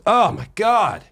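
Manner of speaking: imitating the doctor's stunned gasp of relief; retold disbelief. Doctor hearing the patient eats only prosciutto, a high stunned exclaim. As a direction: pitch leaps high in mock disbelief.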